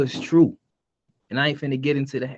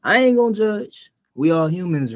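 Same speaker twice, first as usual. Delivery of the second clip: ordinary talk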